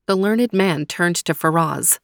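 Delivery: ordinary delivery, nothing marked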